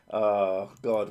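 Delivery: usual speaking voice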